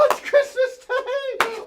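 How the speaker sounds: high, slow laughing exclamation; laughing delight. Acted. Scrooge hears from the children it is Christmas, laughing with delight. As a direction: savour the news with a laugh.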